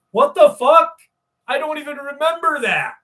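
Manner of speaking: high, incredulous outburst, loud then dropping in volume; shocked reaction, retold. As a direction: blurt it out in replayed disbelief. Retells shocked reaction to show.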